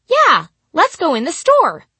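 high, loud eager agreement; eager child line. Retold or imitated.

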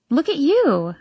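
pleased, high-pitched exclaiming; smiling exclamation. A narrator's reading. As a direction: exclaim it with a delighted smile. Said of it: "I exclaim with a smile".

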